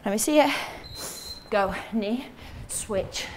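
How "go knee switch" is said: out of breath, barely voiced cues; breathless cues. Real, not acted. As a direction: gasp the cues out between efforts. Coach breathless mid exercise.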